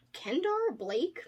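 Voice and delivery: ordinary delivery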